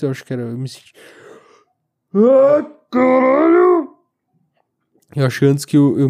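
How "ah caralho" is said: sudden high, loud cry, drawn out; startled outburst. Real, not acted. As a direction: blurt it out in shock. Sudden outburst mid-sentence.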